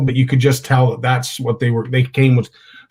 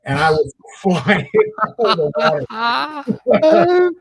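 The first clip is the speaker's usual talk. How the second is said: high, drawn-out laugh through the words; slow, high-pitched, helpless laughter mid-sentence; 10 semitones higher, much slower than usual. Real, not acted. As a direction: break up laughing while telling your own escape. Laughing at his own panicked escape.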